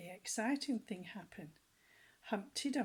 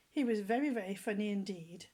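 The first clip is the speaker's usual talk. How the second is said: ordinary delivery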